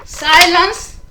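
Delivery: sharp rise in pitch and volume, imitating her; retold loud command. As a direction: mimic someone loudly calling for silence. Retells a lady silencing her.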